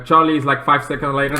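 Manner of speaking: normal talking voice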